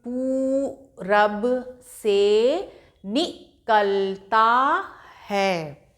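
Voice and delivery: put-on each word drawn out slowly for a child; slow syllable-by-syllable reading; slow reading aloud. Shown as a demonstration. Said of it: "एक बार फिर से हम पढ़ने का अभ्यास करते हैं।".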